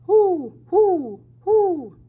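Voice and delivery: high, loud owl hoot. A narrator's reading. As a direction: call out like an owl in the night. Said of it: "a stranger called out".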